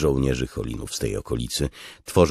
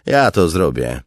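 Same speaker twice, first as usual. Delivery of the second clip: high, loud resolve; forceful officer; 5 semitones higher, 10 dB louder than usual. Acted. Officer offers to kill the captive himself, forceful raised voice. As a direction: declare it forcefully.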